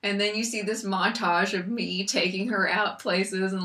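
normal talking voice

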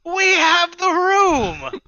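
high, slow drawn-out chant; squatters in unison. Acted. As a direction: stretch it as two voices at once. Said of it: "as they both say in unison".

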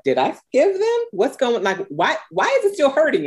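high, confused, pleading questions to herself; bewildered self-questioning. Retold or imitated. Woman re-triggered asks herself why it still hurts, high and bewildered. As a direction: voice jumps up in disbelief at the lingering hurt. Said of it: "you're like".

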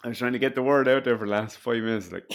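normal talking voice

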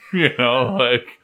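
ordinary talk, nothing marked

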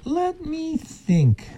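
high, slow musing fox; sly silky fox. Acted. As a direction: draw it out like a scheming fox. Fox ponders.

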